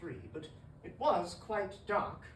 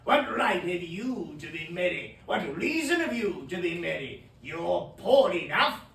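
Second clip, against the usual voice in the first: loud, hard demands; angry scolding; 9 dB louder than usual. Acted. Scrooge berating his poor nephew, loud and harsh. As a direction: lash out at him.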